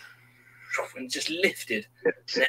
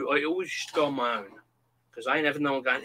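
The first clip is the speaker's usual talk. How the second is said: ordinary talk, nothing marked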